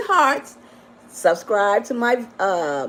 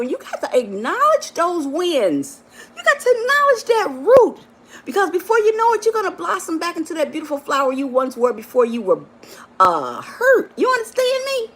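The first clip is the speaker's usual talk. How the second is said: tearful rising emotion; 8 semitones higher than usual. Real, not acted. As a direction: voice rising high into a cry. Emotional peak, laugh then cry.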